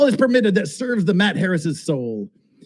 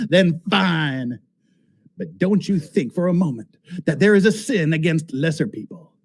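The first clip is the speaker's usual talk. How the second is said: ordinary talk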